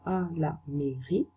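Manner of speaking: slow, soft repeat of आ ला मेरी; teacher repeating the French slowly; repeated slow model. Shown as a demonstration. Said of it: "pronunciation दोबारा से बता रही हूँ".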